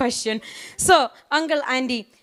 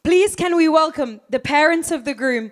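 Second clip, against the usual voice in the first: rising louder crowd call; building announcement shout; 5 semitones higher, 5 dB louder than usual. Real, not acted. Host calls parents to applause.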